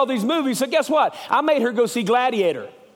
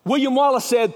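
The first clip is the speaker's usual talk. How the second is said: normal talking voice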